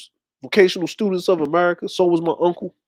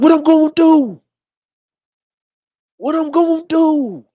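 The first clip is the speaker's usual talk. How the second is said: put-on upset kid; 10 semitones higher, 3 dB louder than usual. Son sees his money go, high upset protest. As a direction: wail it like a kid watching his pay vanish. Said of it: "I was getting upset".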